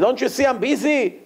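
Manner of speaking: put-on high, sharp irritated snap at a child; angry father. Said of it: "he got angry and said".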